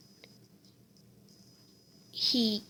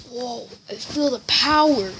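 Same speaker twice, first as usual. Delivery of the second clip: mild awe. Acted. Deku receives One For All.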